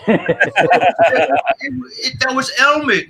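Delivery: put-on high Brummie accent with a laugh in it. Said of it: "I'm gonna do a terrible accent".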